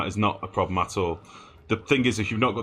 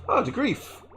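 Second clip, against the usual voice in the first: squeaky, much higher jokey voice; silly high voice. Pretend. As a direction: pitch it way up as a playful gag. Reading a chat name in a funny voice.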